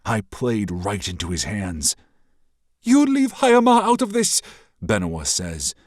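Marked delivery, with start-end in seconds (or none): 2.8-4.4 high, loud, frightened outburst; scared. Acted. Princess fears for her home, high and scared. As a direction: shout it but fear shows. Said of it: "but her voice is scared".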